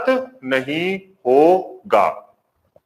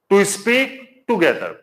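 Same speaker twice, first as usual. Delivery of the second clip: slow dictation; 2 semitones higher, much slower than usual. Shown as a demonstration. Teacher dictating the English meaning.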